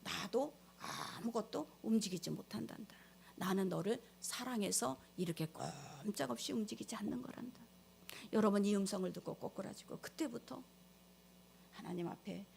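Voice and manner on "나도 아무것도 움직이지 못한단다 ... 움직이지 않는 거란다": softer, hushed voice of Jesus; gently as Jesus comforting her; a quiet, tender Jesus, fast at the end; Jesus speaking. Acted. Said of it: "예수님께서".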